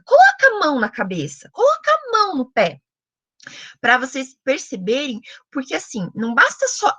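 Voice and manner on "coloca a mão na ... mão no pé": put-on high, sing-song order to a toddler; lightly voiced talk to a little child; comando para bebê. Shown as a demonstration. Models orders given to baby. (comando agudo, como para criança pequena)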